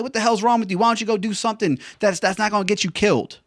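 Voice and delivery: high-pitched, indignant scolding in imitation; freaked-out grandmother. Grandmother scolding grandson over flight school, freaked out. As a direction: play a mad grandmother tearing into someone. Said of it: "She freaked out".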